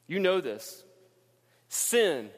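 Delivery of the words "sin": ordinary talk, nothing marked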